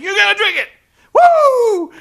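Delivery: high, loud whooping wrestler impression; hammed-up jubilant shouting with big whoops; Ric Flair celebrating. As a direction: holler it like a showboat mid-party. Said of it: "Ric Flair's all celebrating".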